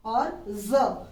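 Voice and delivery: ज stretched slowly as a buzzing ज़; slow nuqta z sound. Shown as a demonstration. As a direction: hold ज long, tongue touching the palate, buzz it like z. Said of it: "इस ज को जब बोलेंगे".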